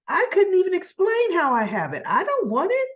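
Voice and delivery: high-pitched retold protest; emphatic refusal. Soap heroine refuses the stolen necklace, mock protesting. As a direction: push it away with a high, insistent voice.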